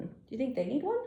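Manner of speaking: plain reading voice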